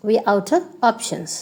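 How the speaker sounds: "out of" as outta, "We are" as we're; shortened form. Shown as a demonstration. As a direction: drop the f sound in "out of". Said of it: "you can say".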